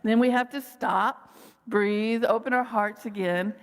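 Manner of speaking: ordinary delivery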